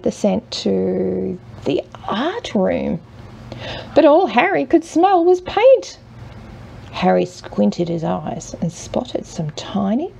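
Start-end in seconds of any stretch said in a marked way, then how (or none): 3.9-6.0 mock dismay. A narrator's reading. Storyteller's dramatic reveal.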